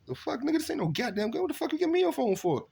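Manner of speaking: what is this, a phone?; confused outrage. Retold or imitated. To cousin who handed him a phone, high baffled complaint. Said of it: "I'm like".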